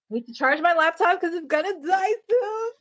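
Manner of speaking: high mock distress shout; high whiny wail. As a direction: wail it like the laptop is dying. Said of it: "Uh-oh".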